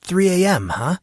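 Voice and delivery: half-awake surprise at the hour; groggy Monson, musing. Acted.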